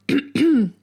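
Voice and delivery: clears throat; harsh throat clear. Real, not acted. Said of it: "ich hab irgendwas im Hals".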